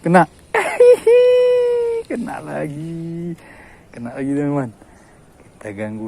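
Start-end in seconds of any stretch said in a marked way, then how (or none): 0.3-2.0 high, loud cry of laughter; a loud, high gleeful laugh; shrieking laugh. Real, not acted. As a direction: shriek with delight. Laughing, catch again.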